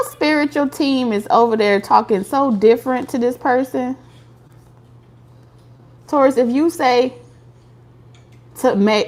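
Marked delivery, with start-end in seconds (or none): none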